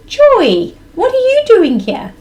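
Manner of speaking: high, loud imitation of mom; mom's voice. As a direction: call out as a startled young mom, high-pitched. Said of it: "with my mom's voice".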